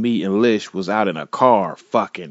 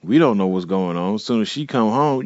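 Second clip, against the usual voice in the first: usual speaking voice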